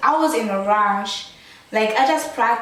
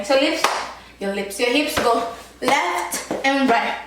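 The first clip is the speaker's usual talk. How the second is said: ordinary delivery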